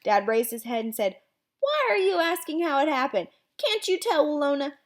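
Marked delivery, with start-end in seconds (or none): 1.6-4.8 high, incredulous dad; amused disbelief. Acted. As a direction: ask it in mock amazement. Dad stifling laughter, mock disbelief.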